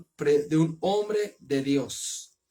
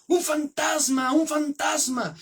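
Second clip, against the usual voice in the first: high, drawn-out cry of terror; frightened cry; 9 semitones higher than usual. Acted. Disciples on the boat see a ghost, a high frightened cry. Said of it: "y gritaron de miedo".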